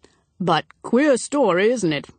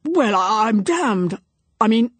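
sudden startled oath, drawn out; startled exclamation; 3 semitones higher, 4 dB louder, slower than usual. A narrator's reading. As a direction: blurt it out, caught off guard. Major startled by approaching voices.

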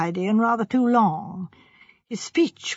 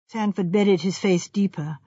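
plain reading voice

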